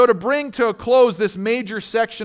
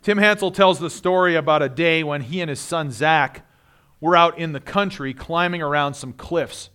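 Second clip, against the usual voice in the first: ordinary delivery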